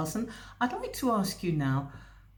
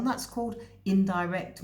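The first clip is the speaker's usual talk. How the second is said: ordinary delivery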